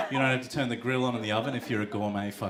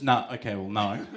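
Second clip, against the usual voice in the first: normal talking voice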